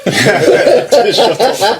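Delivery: laughing through the words; laughing repeat. Real, not acted. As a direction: echo it while laughing, drawn out slowly. Repeating friend's retort, laughing.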